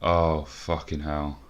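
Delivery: ordinary talk, nothing marked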